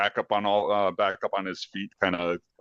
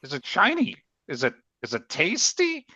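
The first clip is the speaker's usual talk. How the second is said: eager rat voice; 8 semitones higher than usual. Acted. Rat character, eager questions.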